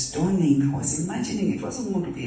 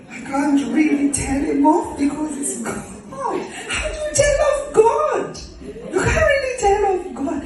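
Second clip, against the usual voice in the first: high-pitched, incredulous protest; exasperated disbelief; 12 semitones higher than usual. Acted. Mary wondering how to discipline a divine child, exasperated disbelief. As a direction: build to a squeal of bafflement.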